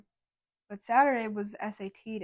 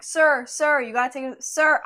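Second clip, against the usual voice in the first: yelling; 3 semitones higher, 8 dB louder than usual. Retold or imitated. Cashier yelling at a customer who ignores her, loud. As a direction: shout it across the store. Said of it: "I'm yelling at him".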